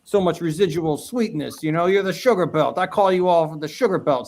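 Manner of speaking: put-on teasing friend in New York accent. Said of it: "in his New York accent".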